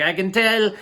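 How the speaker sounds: a badly faked Afghan accent, breaking off. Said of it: "I can't do that kind of accent".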